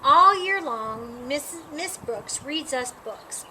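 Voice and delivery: plain reading voice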